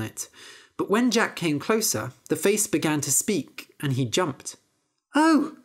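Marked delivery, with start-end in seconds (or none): 5.1-5.6 high startled "Oh!" from the harp; startled cry. Acted. Harp startled by Jack, high yelp. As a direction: yelp in surprise, high and sharp. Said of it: "cried the harp".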